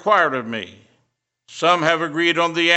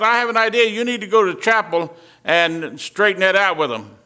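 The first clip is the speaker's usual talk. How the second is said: insistent demand; 6 semitones higher, 4 dB louder than usual. Retold or imitated. Upset man's demand retold.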